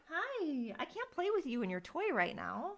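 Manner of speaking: high, hushed cooing to a pet; soft pet talk. Real, not acted. Podcaster turning down a pet with a toy, soft pet talk. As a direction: gently apologise in a small high voice. Talking to pet wanting to play.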